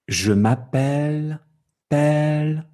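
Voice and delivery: "Je m'appelle" with "pelle" held long and high; lengthened last syllable. Shown as a demonstration. Teacher showing French accent, last syllable lengthened. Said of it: "C'est plus long".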